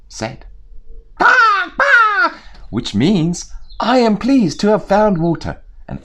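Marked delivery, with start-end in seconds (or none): 1.2-2.4 high loud squawking shout; crow shout. Acted. 3.8-5.6 high, loud crow voice; a satisfied bird announcing its find; pleased crow. Acted.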